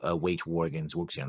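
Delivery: normal talking voice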